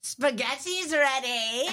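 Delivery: high, drawn-out cartoon skeleton holler; shout. Papyrus calls everyone from the kitchen, shouting. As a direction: call it out long and high. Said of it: "shouts Papyrus from the kitchen".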